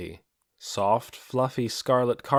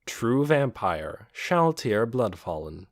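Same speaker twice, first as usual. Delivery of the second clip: usual speaking voice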